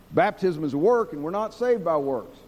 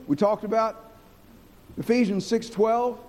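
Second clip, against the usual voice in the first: usual speaking voice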